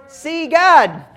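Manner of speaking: put-on high, loud childish demand; toddler-like Philip. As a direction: ask for God like a toddler wanting the turkey. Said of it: "Philip says".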